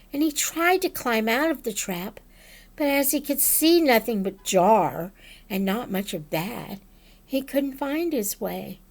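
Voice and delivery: ordinary delivery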